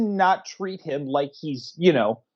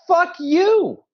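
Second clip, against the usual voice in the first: yelled high and loud; furious shout; 12 semitones higher, 6 dB louder than usual. Retold or imitated. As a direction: scream it at the family. Kevin yelling at family.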